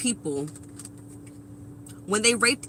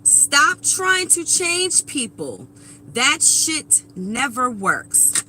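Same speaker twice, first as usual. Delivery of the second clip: emphatic repeat; 2 semitones higher, much slower than usual. Real, not acted. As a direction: drive each word home slowly. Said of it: "Say it with me".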